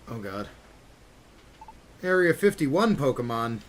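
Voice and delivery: normal talking voice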